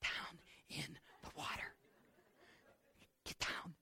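hissed whisper, no voice; mother whispering to her son in the water; urgent whisper. Acted. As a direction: whisper fiercely so no one notices. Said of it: "Mary's calling out".

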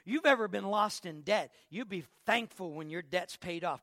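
ordinary delivery

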